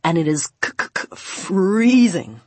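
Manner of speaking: teeth chattering, laughing stammer; shivering with cold. Acted. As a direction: stutter from the cold, then laugh. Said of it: "he laughed".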